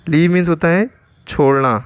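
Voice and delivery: normal talking voice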